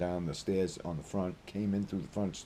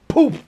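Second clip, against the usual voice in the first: big shouted poof sound effect; loud poof; 16 dB louder than usual. Real, not acted. To viewers, miming a smack, shouted poof. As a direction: yell the poof like an explosion.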